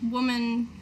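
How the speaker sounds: ordinary delivery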